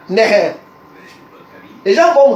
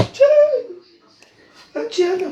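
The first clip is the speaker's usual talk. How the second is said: a high, drawn-out mocking Tiens; high sneering Tiens; taunting exclamation; 10 semitones higher, much slower than usual. Real, not acted. Taunting rival in live rant.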